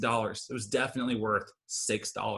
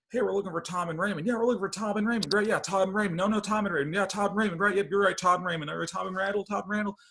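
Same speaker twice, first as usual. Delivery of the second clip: high, loud radio back-and-forth; walkie-talkie chatter; 7 semitones higher, 5 dB louder, faster than usual. Retold or imitated. Rescuers relaying names over walkie-talkie, loud and high.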